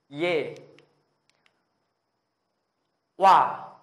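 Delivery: loud, forceful vowel drill; full breath. Shown as a demonstration. Teacher models vowels for Korean learners, at full breath. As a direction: push each syllable out on a big breath. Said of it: "cứ lấy hơi hết sức ra".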